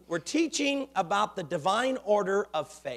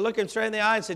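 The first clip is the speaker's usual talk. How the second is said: ordinary delivery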